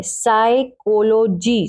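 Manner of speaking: साइकोलॉजी very slow, silent P; correct pronunciation. Shown as a demonstration. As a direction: stretch out "साइकोलॉजी" with no P sound. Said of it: "इसको यहां से शुरू करेंगे". ("साइकोलॉजी" बहुत धीरे, P के बिना)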